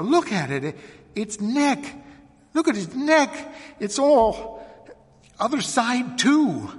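very high, agitated, broken phrases; horrified cry. A narrator's reading. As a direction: cry out urgently, stumbling over the words. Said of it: "cried Missus Hale".

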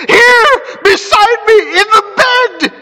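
very high, terrified shriek; screaming. A narrator's reading. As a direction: scream it out, near tears. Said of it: "he screamed sharply".

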